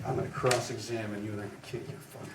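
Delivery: mock cocky lawyer. To the class, mocking a cocky lawyer's swagger. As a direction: ham up the tough-guy lawyer. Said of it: "Ever see people, they get up and they're like".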